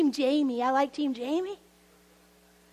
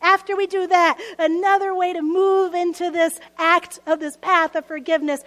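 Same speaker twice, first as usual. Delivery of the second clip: normal talking voice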